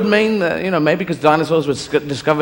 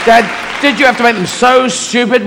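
pitched-up whine at Dad; complaining son; 7 semitones higher than usual. Acted. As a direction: protest to Dad, higher-pitched. Jesus complains to God.

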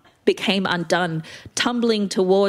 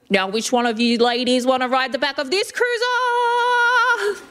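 showman's call; 8 semitones higher than usual. Acted. Stripper teasing the ladies at a party, showman's call. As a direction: call it out high, rising into a long held last word.

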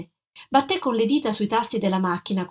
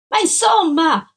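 sudden high, loud cry; loud exasperated shout; 9 semitones higher, 6 dB louder than usual. Acted. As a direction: yell it out in exasperation.

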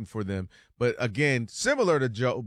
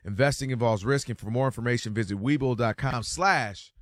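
upbeat radio sponsor read; 4 dB louder than usual. Acted. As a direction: sell it like a radio spot. Reads a sponsor ad.

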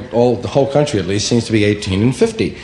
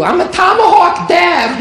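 put-on much higher, louder proud girl voice; a teenage girl proud of her gang; girly Deb voice; 6 dB louder than usual. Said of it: "What are you?".

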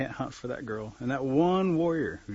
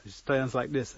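plain reading voice

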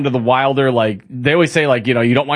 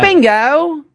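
Bandit voice. Pretend. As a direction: call it out like an excited Aussie dad. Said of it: "that was a little better".

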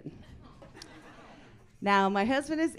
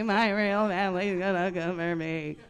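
freaking out, repeated; 6 dB louder than usual. Retold or imitated. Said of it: "She started freaking out".

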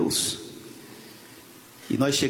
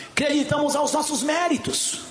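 emphatic preaching; 13 semitones higher than usual. Real, not acted. Preacher bursts out after quiet lines.

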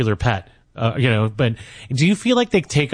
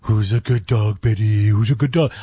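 pet-talk voice; 3 semitones lower than usual. Acted. Host imitates talking to a pet.

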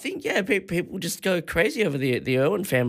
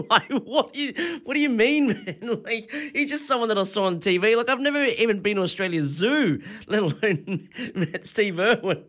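laughing disbelief; 8 semitones higher than usual. Real, not acted. Laughing incredulous retelling.

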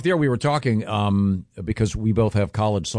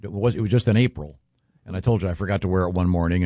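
ordinary talk

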